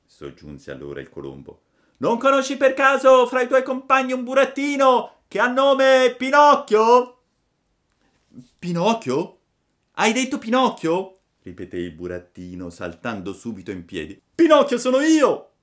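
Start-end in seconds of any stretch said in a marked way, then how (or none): 2.0-7.2 loud, high, long-drawn call; shouted from high above. A narrator's reading. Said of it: "gli gridò". 8.6-11.2 high, loud puppet voice, astonished; amazed child puppet; startled question. A narrator's reading. Puppet startled at hearing his name. 14.3-15.5 very loud, high puppet cry; thrilled child puppet announcing himself; excited exclamation. A narrator's reading. Puppet leaps up claiming his name.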